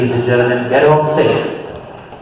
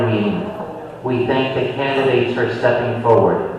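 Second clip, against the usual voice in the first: prayer; 6 semitones lower than usual. Acted. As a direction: lead the closing prayer gently. Closing prayer at meeting.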